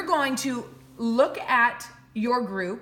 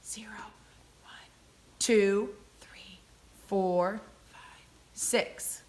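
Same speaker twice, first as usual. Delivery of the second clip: counting in a soft whisper; 2 semitones lower, 3 dB quieter, slower than usual. Shown as a demonstration. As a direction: whisper the numbers to yourself. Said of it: "So I'll show you that".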